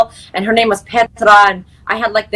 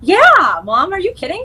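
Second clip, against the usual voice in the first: excited high reply; 9 semitones higher than usual. Retold or imitated. Retold exchange with mom.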